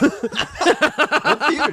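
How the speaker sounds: asking through laughter, slow; laughing. Real, not acted. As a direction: laugh out the question. Laughing question.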